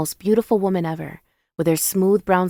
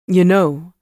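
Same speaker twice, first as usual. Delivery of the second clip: louder, mildly conspiratorial lead-in; 6 dB louder than usual. A narrator's reading. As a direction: draw them in, as if plotting. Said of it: "his tone conspiratorial".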